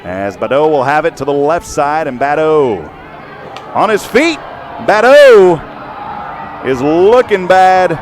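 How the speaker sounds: excited call building to a high shout; live play-by-play. Real, not acted. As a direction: shout the runner's name as he breaks away.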